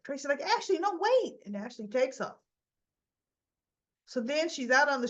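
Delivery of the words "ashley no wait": high, urgent plea to stop; calling after. Acted. Tracy calling after Ashley as she leaves, acted urgent call. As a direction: call after a friend walking off.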